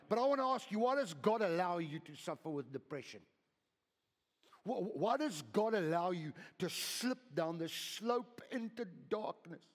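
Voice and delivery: loud rising preacher's question; impassioned preaching. Acted. Pastor challenges the congregation about depression, loud and urgent. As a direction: press the question on the church.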